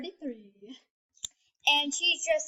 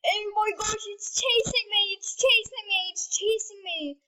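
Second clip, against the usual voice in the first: higher pitched, frantic cries; hammed-up fearful yelling; panicked yelling; 5 semitones higher than usual. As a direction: yell in mock panic while running. Fleeing the monster in game.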